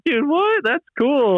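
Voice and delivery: high, surprised "dude, what". Retold or imitated. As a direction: squeak out surprised delight. Quoting himself, surprised.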